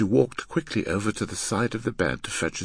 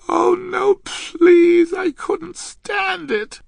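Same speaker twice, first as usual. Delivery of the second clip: very high, loud, slow plea; distressed girl; 9 dB louder, much slower than usual. Acted. Girl begging to be spared practice, distressed. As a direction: wail the plea in a girlish voice.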